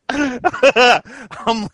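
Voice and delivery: helpless laugh spilling into the words; real laughing. Real, not acted. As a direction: try to speak through your own laughter. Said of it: "as much as I'm laughing here".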